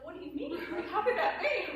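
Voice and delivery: loud, high mock disbelief; incredulous. People reacting in shock to her wild relationship story, incredulous. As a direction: imitate stunned listeners, high and laughing.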